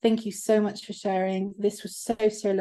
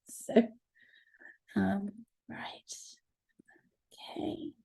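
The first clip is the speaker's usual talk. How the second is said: whisper; 8 dB quieter than usual. Real, not acted. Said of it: "storyteller settles in".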